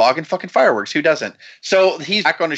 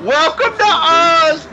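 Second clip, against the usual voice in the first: high, drawn-out mock announcer cry; imitating a costumed greeter's repeated high call; masked old man; 12 semitones higher, 4 dB louder, slower than usual. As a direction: belt it out high and slow, mimicking the old man. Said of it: "you keep hearing him say".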